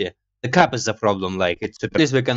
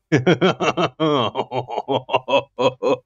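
giddy extended laughter; long laughing fit. Real, not acted. Gamer cracking up at himself, long real laugh.

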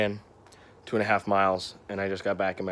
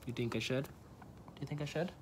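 soft to the dog; 4 semitones higher, 11 dB quieter than usual. Real, not acted. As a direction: ask the dog gently under your breath. Softly asking the dog.

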